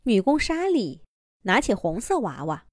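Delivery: ordinary delivery, nothing marked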